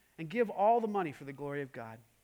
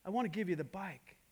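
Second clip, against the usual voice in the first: soft, lower, a touch of choked emotion; choked-up tears; 3 semitones lower, 8 dB quieter than usual. Retold or imitated. Rich giving his new bike to the neighbor, quietly choked up. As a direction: say it as if fighting back tears, understated. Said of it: "through some choked up tears".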